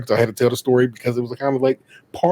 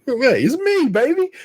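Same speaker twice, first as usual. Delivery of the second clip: high-pitched, playful announcement; showy greeting; 12 semitones higher, slower than usual. Retold or imitated. Son greeting mama at the door, playful showy greeting. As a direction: imitate a grand surprise entrance, pitched up.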